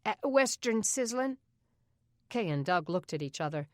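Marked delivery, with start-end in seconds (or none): 0.1-1.4 lightly voiced boy, higher; a kid answering innocently; boy's voice, higher, innocent; child's voice. Boy names mother's old job.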